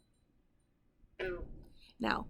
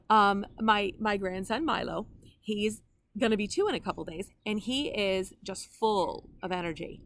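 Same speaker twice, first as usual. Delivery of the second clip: ordinary talk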